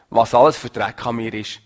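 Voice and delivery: ordinary talk, nothing marked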